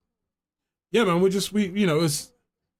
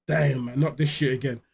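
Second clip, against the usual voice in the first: weary groan; 6 semitones lower, 3 dB quieter than usual. Retold or imitated. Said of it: "you're just like".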